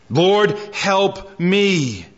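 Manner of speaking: very slow, stretched-out begging; drawn-out plea. Retold or imitated. Preacher repeating the woman's second cry to Jesus, very slow pleading. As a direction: draw the plea out, word by word. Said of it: "Second cry that echoes".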